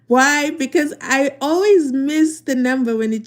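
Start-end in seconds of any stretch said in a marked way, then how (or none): none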